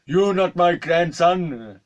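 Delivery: high, loud, drawn-out mock wail; hammed-up hysterical accusation; hysterical old man. Old man being ushered out, hysterical. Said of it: "he's getting quite hysterical".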